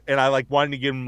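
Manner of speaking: ordinary delivery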